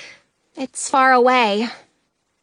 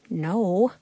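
ordinary delivery

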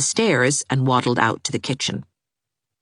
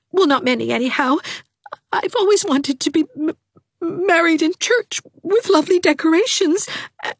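put-on much higher, weepy girl's voice; weepy young girl; tearful Dovey. A narrator's reading. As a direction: wail her misery.

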